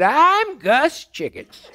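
put-on high, slow folksy old codger; old prospector. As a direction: drawl it like an old gold miner. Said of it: "That's the way he talked".

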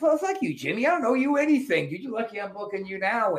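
retold comeback. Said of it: "I'm like".

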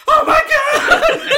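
very high, drawn-out mock scream. Imitating the burning lady, hammed-up screaming. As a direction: shriek it loud and long, hammed up. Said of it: "she's, like, screaming".

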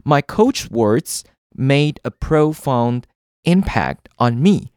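slow, carefully spaced example reading; slow example sentence. Shown as a demonstration. Teacher reading an example sentence slowly to learners. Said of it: "给大家一个例句".

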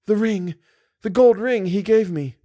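high-pitched dismay over a loss; distraught heroine. A narrator's reading. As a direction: gasp out the news in a high, stricken voice. Distraught Christine reveals lost ring.

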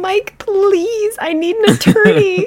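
very high, loud whiny imitation; hammed-up begging for help; mock pleading. Mimics the played phone call pleading.

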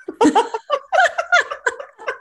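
a long, very high real laugh. Real, not acted. Laughing after husband's story.

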